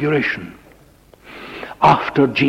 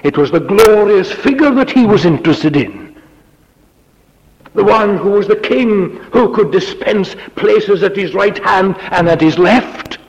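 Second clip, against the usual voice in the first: louder and higher, insistent; full-force preaching; 8 semitones higher, 5 dB louder than usual. Acted. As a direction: hammer home what Peter wanted.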